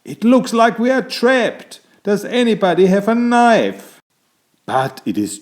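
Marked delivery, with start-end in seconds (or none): 0.0-4.0 much higher, louder worried voice; a friend panicking in the net; alarmed companion. A narrator's reading. Trapped companion asking the others for a knife, much higher and louder.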